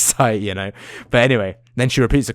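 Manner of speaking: normal talking voice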